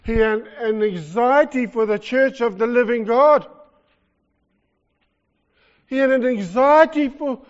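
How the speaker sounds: preaching climax. Real, not acted. Preacher to congregation, at full force on Paul's anxiety. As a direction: drive the point home hard.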